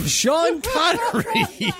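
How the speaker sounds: high, slow, laughing through the name; losing it in real laughter mid-read; cracking up. Real, not acted. Cracks up restarting the item.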